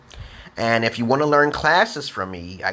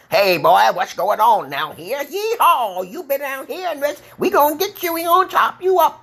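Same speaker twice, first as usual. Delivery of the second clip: hammed-up Southern yokel, pitched way up; Southern accent. Shown as a demonstration. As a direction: whoop it like a cartoon Southern hillbilly. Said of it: "I know how to speak Southern".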